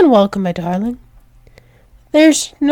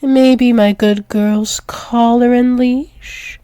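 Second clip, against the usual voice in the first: usual speaking voice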